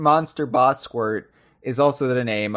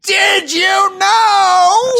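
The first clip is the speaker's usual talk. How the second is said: drawn-out, very high mock-announcer shout; very loud shout; 9 dB louder, much slower than usual. Host obeys co-host's demand for louder, hammed-up shout. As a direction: bellow it high and stretch every word.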